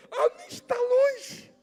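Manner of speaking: pitched far up and slow, drawing out "longe"; high, slow, drawn-out emphasis. Shown as a demonstration. Preacher stressing the distracted mind, high and slow. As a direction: lift it high and stretch "A mente tá longe". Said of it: "repeated line".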